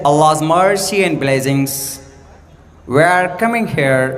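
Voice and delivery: slow, drawn-out mock English accent. Shown as a demonstration. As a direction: sound a little like an Englishman. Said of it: "اس میں تھوڑا انگریز کی طرح لگتا ہے".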